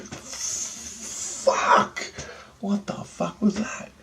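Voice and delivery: shocked. Retold or imitated. Punched awake in the dream.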